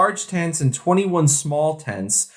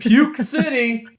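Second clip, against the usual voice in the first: mock announcement; 10 semitones higher, slower than usual. Real, not acted. Joking about drunk riders.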